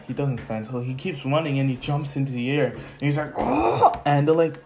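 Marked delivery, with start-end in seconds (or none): 3.4-3.9 much higher effortful cry; leaping battle cry. Acted. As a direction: shout as you jump. Zuko leaps into the air.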